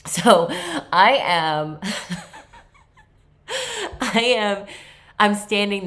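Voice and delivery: giggling through the words; laughing. Real, not acted. Telling friends about the missed flight, laughing. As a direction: laugh while you get it out. Laughing at own airport mishap.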